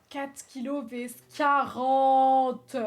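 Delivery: loud and slow, like a fight card; slow announcer emphasis. Acted. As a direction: announce it slowly and loudly. Dramatic weight comparison.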